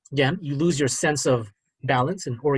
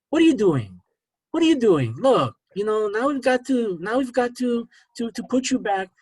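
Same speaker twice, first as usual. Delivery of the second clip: overheard nurse's voice; 9 semitones higher than usual. Retold or imitated. Hospital voice to disoriented patient trying to get up, high-pitched scolding. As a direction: imitate someone taking charge of a patient, voice raised high. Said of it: "And I heard a voice".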